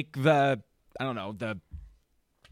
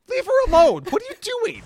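much higher, louder mock scolding, laughing; exasperated yell; 7 dB louder, faster than usual. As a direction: yell it half-laughing at a fussy kid. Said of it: "I'll be like".

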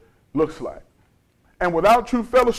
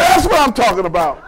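imitated mother bursting out; emphatic approval; 4 semitones higher, 5 dB louder than usual. Mother approves her son's answer about Jesus, emphatic. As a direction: exclaim it in raised, forceful approval.

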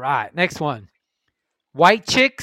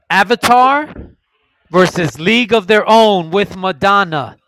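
loud, high announcer call; hype announcement; 4 semitones higher, 12 dB louder than usual. Real, not acted. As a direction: belt the title like a ring announcer. Announcing the matchup.

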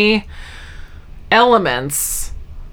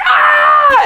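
high-pitched comic shriek; imitated scream of horror, far above usual pitch; hammed-up freak-out scream; mock panicked scream; 4 dB louder than usual. Acting out his freak-out reaction.

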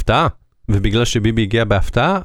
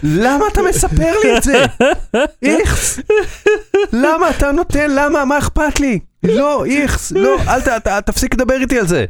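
high, squeamish yuck voice, pushing it away; disgusted protest; slower than usual. Retold or imitated. To a taxi driver boasting about a brothel, high disgusted protest. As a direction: recoil in mock disgust, voice pitched high. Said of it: "ואמרתי לו".

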